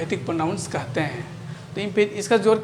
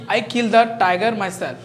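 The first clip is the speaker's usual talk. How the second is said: slow, deliberate reading of the sentence; slow dictation; 5 dB louder, much slower than usual. Shown as a demonstration. Teacher to students, reading the example slowly. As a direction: spell it out slowly for the class. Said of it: "अब इसको समझें".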